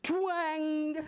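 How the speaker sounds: high, ringing twang; drawn-out storybook twang; twang sound effect. A narrator's reading. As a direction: imitate a plucked web string. Sound word in picture book.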